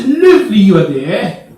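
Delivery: loud mock Cockney, starting high and falling; Cockney accent. Cockneys greet you there, in loud Cockney accent. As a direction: call it out in hammed-up Cockney. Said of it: "they say".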